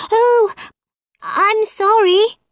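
high, startled "oh" and apology; dismayed apology. Acted. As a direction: blurt a high-pitched, alarmed apology. Alice upsets the mouse.

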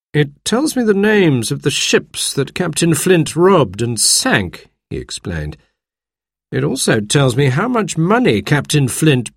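doctor lays out what he has heard; lightly voiced doctor. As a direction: speak as the measured, explaining doctor. Doctor reads from the book.

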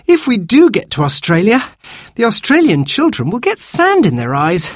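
put-on high-pitched child's voice; fretful little girl. Youngest child wants to stop digging, high and fretful. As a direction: voice a small girl raising a worry.

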